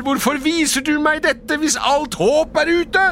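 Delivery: loud, high-pitched begging; desperate pleading. A narrator's reading. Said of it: "ropte Scrooge desperat". (desperat, høylytt bønn)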